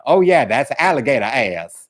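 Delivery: mock Southern bluesman jive accent; jive-talk accent. Mocking [name] in his blues band, fake jive-talk accent. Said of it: "he's all talking like he's all jive talking man".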